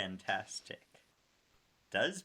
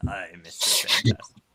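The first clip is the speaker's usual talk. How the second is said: hero announcement; 3 semitones lower, 10 dB louder than usual. Acted. Kid announcing superhero.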